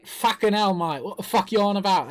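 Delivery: badly faked Australian accent, quick; hammed-up Aussie mate accent; mock Australian accent. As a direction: rattle it off in fake Australian. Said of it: "That's totally Australian".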